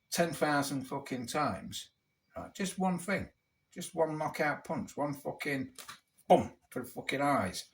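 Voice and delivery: ordinary talk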